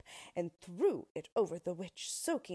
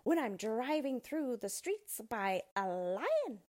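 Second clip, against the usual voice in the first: high, slow, smug witch voice; gloating witch; 4 semitones higher, 4 dB louder, slower than usual. Acted. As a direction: savour it like a vain witch. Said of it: "she thought".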